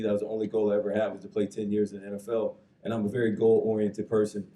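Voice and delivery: normal talking voice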